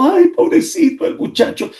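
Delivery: high, keening cry of grief; wailing women. Retold or imitated. Church women crying over the fallen boy, high wail. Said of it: "Todas gritando".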